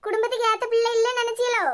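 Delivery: high-pitched, offended questioning; indignant challenge. Acted. Wife challenges husband in a quarrel. (கோபமாக எதிர்த்துக் கேட்பது)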